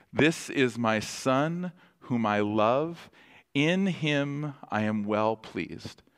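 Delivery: slow, gentle quote; voice of God. Retold or imitated. Pastor repeating the Father's blessing, slow and gentle. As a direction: let each phrase settle slowly. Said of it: "God the Father says over him".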